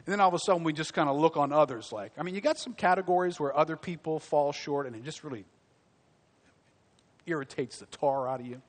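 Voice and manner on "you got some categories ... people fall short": usual speaking voice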